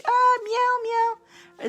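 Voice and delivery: very high-pitched playful mewing; kitty cat meows. Pretend. Host doing Bambi's kitty cat request, high meows. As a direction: pipe up as a little kitty cat. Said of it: "this was the kitty cat".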